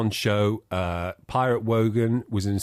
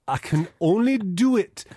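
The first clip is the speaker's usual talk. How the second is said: slow, higher-pitched, laboured Geordie; bad Geordie accent; 9 semitones higher than usual. Shown as a demonstration. Host shows the accent he can't do, bad Geordie accent. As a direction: do Geordie badly, slowly and with effort. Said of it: "if I try and do a Geordie accent".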